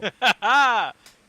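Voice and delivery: high loud burst of laughter. Real, not acted.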